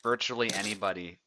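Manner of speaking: lively caster commentary, punching the action calls; live play-by-play. Acted. Commentating a sword duel for stream viewers, lively play-by-play. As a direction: call the fight as it happens for your stream.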